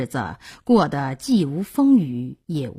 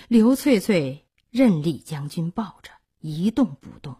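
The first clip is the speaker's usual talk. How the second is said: ordinary delivery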